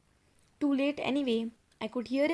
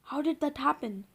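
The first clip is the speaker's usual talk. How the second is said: ordinary delivery, nothing marked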